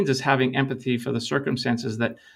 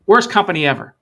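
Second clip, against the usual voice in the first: louder, higher, flat-out blunt answer; blunt verdict; 6 semitones higher, 9 dB louder than usual. Retold or imitated. Retelling a worker's blunt verdict to the consultant, loud and emphatic. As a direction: slam the company in three words.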